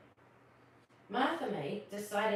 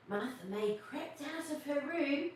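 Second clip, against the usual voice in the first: plain reading voice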